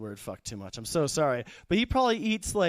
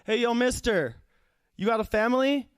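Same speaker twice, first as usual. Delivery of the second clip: lightly voiced teen, pitched up; fifteen-year-old kid; 6 semitones higher than usual. Kid stopping the substitute teacher in the hallway, lightly voiced teen. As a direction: call out higher, like a nosy kid. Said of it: "some fifteen-year-old kid".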